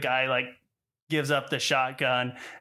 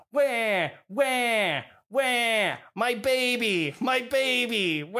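high, drawn-out mock wails; literal fake crying; 9 semitones higher, slower than usual. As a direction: copy crying word for word, badly faked. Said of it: "takes that as a literal interpretation".

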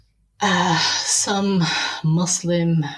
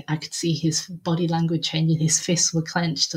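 ordinary talk, nothing marked

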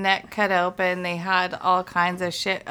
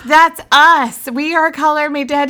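higher and louder proud claim; excited; 6 semitones higher, 7 dB louder than usual. Retold or imitated. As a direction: announce it with a thrill. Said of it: "Yeah, I was like".

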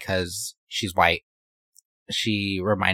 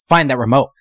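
bossy shout; 3 semitones higher, 8 dB louder than usual. Retold or imitated. Ike barking orders in story.

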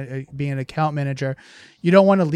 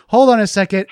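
sharp parental warning; 6 semitones higher, 8 dB louder than usual. Retold or imitated. Parent stops child at stove.

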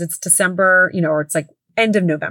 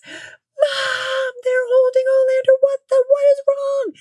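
put-on much higher, shouting panicked child; freaked-out kid; slower than usual. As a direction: shriek like a kid who just saw the elf. Son freaking out in tub.

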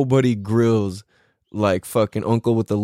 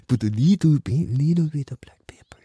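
Filipino uncle whispering "little bit"; whispered Filipino accent. Retold or imitated. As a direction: hush the recipe in a Filipino lilt. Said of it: "can't I not do Filipino accent".

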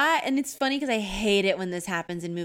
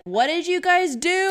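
lightly voiced dad, louder and drawn out; mock a parent scolding the kids, slow and higher; exasperated dad; 9 semitones higher, 6 dB louder, slower than usual. Voicing the annoyed dad.

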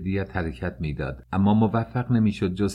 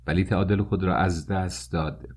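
ordinary delivery

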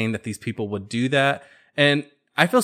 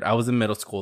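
normal talking voice

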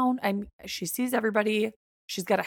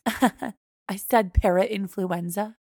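slow condescending repeat; much slower than usual. Retold or imitated. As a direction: drag out each syllable, patronizing. Doctor repeats word slowly.

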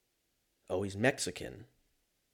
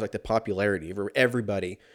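ordinary talk, nothing marked

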